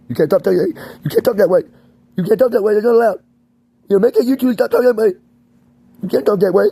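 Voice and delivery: high, loud mock official, insistent and repetitive; scolding authority figure. Staff threatening to medicate him for emotional talk, high mock scolding. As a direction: order him to stop at a high-pitched yell. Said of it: "we don't like the way you talk".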